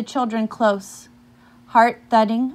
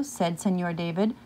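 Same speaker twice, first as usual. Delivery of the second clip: ordinary delivery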